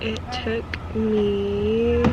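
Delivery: slow, trailing off while checking; drawn out, unsure; hesitant, slow. Real, not acted. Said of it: "let me look at my phone".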